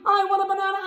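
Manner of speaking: loud. Shown as a demonstration. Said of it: "We'll say the magic words".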